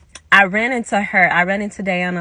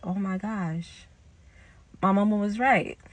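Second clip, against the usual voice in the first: retold realization; 7 dB quieter than usual. Teller to herself after the argument, hushed realization. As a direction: let it sink in quietly.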